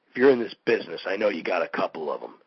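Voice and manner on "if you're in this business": usual speaking voice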